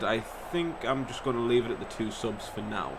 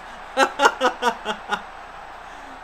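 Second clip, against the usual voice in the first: airy giggle; breathy laugh; 10 semitones higher than usual. Real, not acted. Laughing after the goal, airy.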